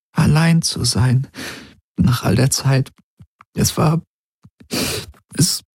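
fighting back tears as she remembers; tearful. A narrator's reading. Ylana confides her loneliness to Galen, close to tears. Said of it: "Sie holte tief Luft".

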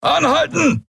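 high, full-voiced roar; bellowed command. A narrator's reading. Captain at roadblock to speeding vehicle, bellowed command. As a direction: roar the order at an oncoming vehicle. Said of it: "brüllte ihnen ein Hauptmann entgegen".

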